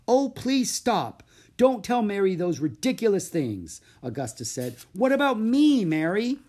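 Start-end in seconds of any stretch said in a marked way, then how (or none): 0.1-3.8 high, loud, exasperated objection; indignant protest. A narrator's reading. Augusta cutting off the praise, loud and exasperated. 4.9-6.4 high, loud, exasperated objection; indignant protest. A narrator's reading. Augusta cutting off the praise, loud and exasperated.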